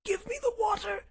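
very high, strained gasp. Acted. Sick Holmes begs visitor for water, gasping. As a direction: gasp the plea out. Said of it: "he gasped".

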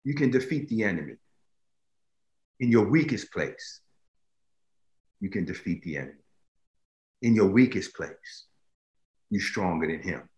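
sudden hush. Acted. Preacher quietly assuring the weak listener. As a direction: drop to a near hush.

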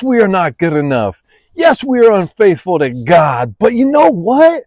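ordinary talk, nothing marked

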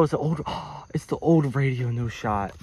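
ordinary talk, nothing marked

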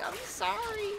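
mock apology in a tiny high voice; squeaky apology. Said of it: "and I'm like".

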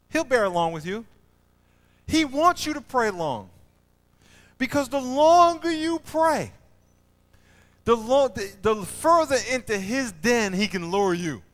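loud, high preaching cry; full-force preaching. Acted. Preacher urging the church to pray long, loud and high. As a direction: lift it up and hold the congregation.

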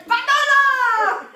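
high, loud, drawn-out holler; yelling Italian mama. Acted. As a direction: call a child like an Italian mama screaming down the alley. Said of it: "das Geschrei der La Mama, die dann zu irgendeinem Kind schreit".

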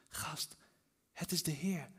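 very soft, confiding aside; hushed realisation. A narrator's reading. The disciple tells Peter who it is, hushed. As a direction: drop to a hush for the discovery.

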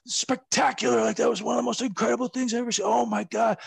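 gushing fan. Retold or imitated. Impersonating Pat Patterson gushing to the wrestler, excited. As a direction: imitate Pat raving about a spot he loved. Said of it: "he was like".

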